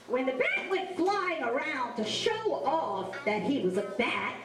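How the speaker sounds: usual speaking voice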